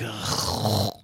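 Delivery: low, drawn-out rasping "ugh"; long throaty noise of distaste; disgusted groan. Retold or imitated. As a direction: groan in weary disgust at bad comedy. Reacting to the panel comedy.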